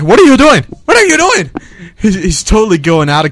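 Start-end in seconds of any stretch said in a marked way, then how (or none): 0.0-1.5 high-pitched alarmed repeat, rising on the second; mock panic. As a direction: imitate a terrified passenger in a speeding cab. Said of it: "the guy was like".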